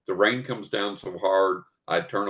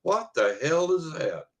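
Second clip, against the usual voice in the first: buddy's surprise; 7 semitones higher than usual. Retold or imitated. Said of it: "he goes".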